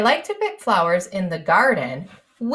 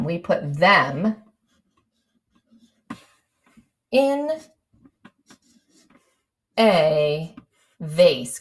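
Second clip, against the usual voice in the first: slow dictation; 3 semitones lower, much slower than usual. Shown as a demonstration. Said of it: "I'm going to write that next".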